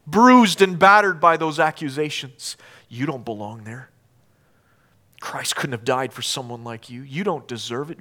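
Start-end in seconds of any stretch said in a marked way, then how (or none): 2.9-8.0 low, soft accusing voice; acted inner accuser, hushed and low; quiet accusation. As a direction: plant the doubt softly. Accusing voice at the Lord's table.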